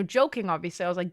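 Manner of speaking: ordinary talk, nothing marked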